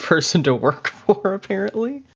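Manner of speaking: ordinary talk, nothing marked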